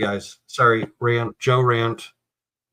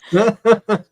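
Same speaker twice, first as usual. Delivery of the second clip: high bursting laugh instead of crying; real laugh; 10 semitones higher, 4 dB louder than usual. Real, not acted. Host laughing at her co-host's blood pressure joke, a bright real laugh. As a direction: laugh it off, close to tears. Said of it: "I'm laughing because I can't cry".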